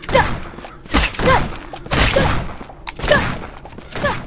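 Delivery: loud, high repeated shouts; shouting. Real, not acted. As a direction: yell each one at the enemy. Shouting at enemy in game.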